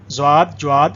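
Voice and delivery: زواد with z, then جواد with j, each with a w glide; both wrong pronunciations. Shown as a demonstration. Teacher lists both mistakes, saying زواد and جواد with glides. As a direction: contrast زواد and جواد as two glided errors. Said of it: "دونوں نہیں ہے".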